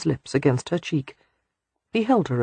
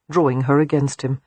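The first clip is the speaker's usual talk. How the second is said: usual speaking voice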